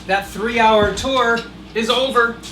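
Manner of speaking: usual speaking voice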